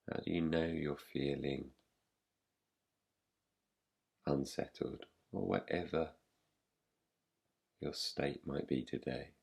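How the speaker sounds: soft, slightly low voice with long pauses; guided meditation. Real, not acted. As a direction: guide them gently into rest, leaving long silences. Leads a yoga nidra practice.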